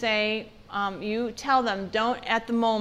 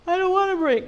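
put-on high, loud protesting child; a kid refusing the break, pushing back loud; whiny protest; 9 semitones higher, 6 dB louder than usual.

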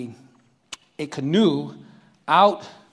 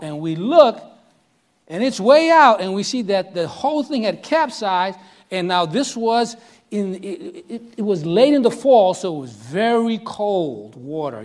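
ordinary talk

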